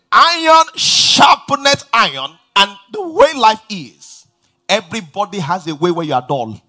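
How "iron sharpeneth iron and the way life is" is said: slow, higher reading of Iron sharpeneth iron; scripture, slowly stressed. Acted. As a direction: lean on each word of the verse. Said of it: "It said".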